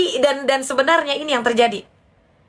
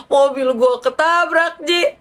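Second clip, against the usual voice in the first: high, slow, whiny protest; wailing friend; 6 semitones higher, slower than usual. Retold or imitated. Friend answers advice by lamenting her car, wailing. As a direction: wail it like a distraught friend.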